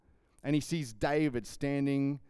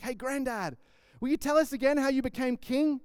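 grandchild; 9 semitones higher than usual. Retold or imitated. Grandchildren asking granddad on throne.

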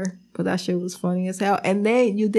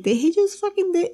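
stunned disbelief; 10 semitones higher than usual. Retold or imitated. Gum kicked far.

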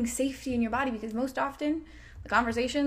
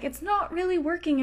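ordinary talk

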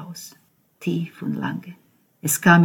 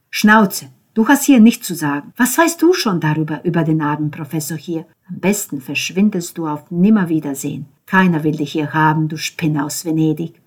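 angry rebuke; 9 dB louder than usual. Acted. Ben snapping at Cesco to get lost, loud and angry. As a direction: tell him to shut up and mean it.

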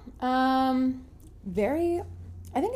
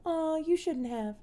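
put-on touched old lady; 7 semitones higher than usual. Playing Titanic lady scene.

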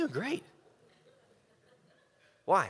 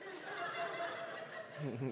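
high laugh; 15 dB quieter than usual. Real, not acted. Laughing at his own joke.

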